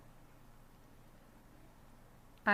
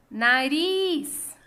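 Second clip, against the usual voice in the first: "Nariz" called loudly, RIZ held long; calling out the word; 3 semitones higher, 5 dB louder than usual. Shown as a demonstration. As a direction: call out "Nariz", stretching the final RIZ. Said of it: "esse exercício de chamar as palavras".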